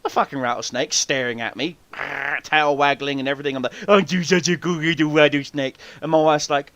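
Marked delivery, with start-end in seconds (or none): 3.9-5.8 put-on cooing baby talk to a pet; puppy talk. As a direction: coo at the snake like it's a puppy. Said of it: "talking to a rattlesnake like a puppy".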